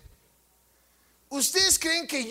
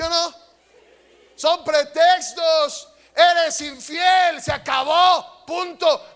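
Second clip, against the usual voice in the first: slow, loud, higher preacher's shout; preaching shout; 5 semitones higher, 6 dB louder, slower than usual. Real, not acted. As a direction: hammer each phrase at the congregation. Preacher thunders against infidelity. (grito de predicador, lento, fuerte y agudo)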